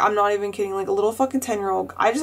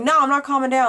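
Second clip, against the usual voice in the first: mild mock yell, pitched higher; retold heated yelling; 5 semitones higher, faster than usual. Student refusing to calm down in the office, retold heated yelling. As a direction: push back heatedly, without really shouting. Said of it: "and I was yelling".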